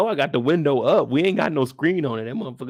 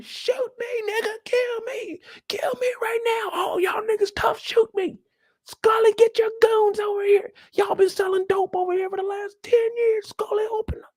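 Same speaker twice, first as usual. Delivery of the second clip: high woman's voice, whispering dares; woman outside daring the dealers to shoot; defiant woman. Retold or imitated. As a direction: taunt them, then plead for Scully. Woman daring them, calling Scully.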